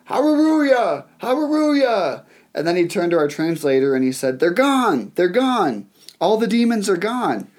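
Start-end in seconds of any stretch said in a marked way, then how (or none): 0.0-2.2 joyful yell. Retold or imitated. Freed man shouting praise over his deliverance, high joyful yell. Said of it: "He yelled". 4.4-7.5 excited cry. Retold or imitated. Freed man telling the translator the demons left, high excited cry.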